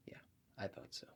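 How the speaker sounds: breathy whispered aside. Acted. ASMR host confirming to the client, whispered. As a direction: whisper it close to the mic.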